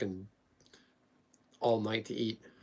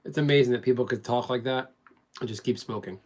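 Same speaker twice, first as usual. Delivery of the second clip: normal talking voice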